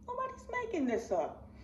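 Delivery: high, emphatic repeat; insistent. Real, not acted. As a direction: push it up hard. Repeats line, much higher.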